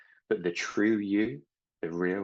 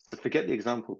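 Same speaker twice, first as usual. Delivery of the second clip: usual speaking voice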